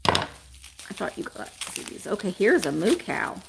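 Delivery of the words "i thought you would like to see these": whispered and much softer. Real, not acted. Seller telling viewers she wanted them to see, whispered. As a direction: say it as a hushed aside. Aside while opening boxes.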